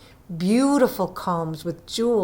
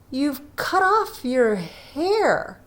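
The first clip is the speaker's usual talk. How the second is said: slow, puzzled question; slow and bewildered; slowly; 7 semitones higher, much slower than usual. A narrator's reading. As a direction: draw it out as if he can't take it in. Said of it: "asked Jim slowly".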